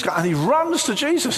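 normal talking voice

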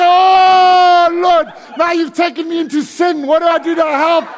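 long wailed "oh", then loud high pleading; hammed-up loud, high distress; panicked cry. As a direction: cry out to heaven in mock alarm. Panicked cry to God retold.

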